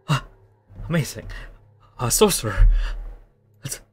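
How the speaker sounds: loud, awed delight; amazed prisoner. Acted. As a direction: marvel at the magic. Prisoner amazed by melting bars.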